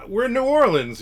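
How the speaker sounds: mock joyous author in New Orleans; imitating excited exclamation. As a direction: burst out like a delighted fan. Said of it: "He's like".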